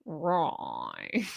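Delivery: low, soft, drawn-out villain catchphrase; Doctor Evil. Retold or imitated. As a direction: stretch the vowel like a campy movie villain. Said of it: "How about Doctor Evil?".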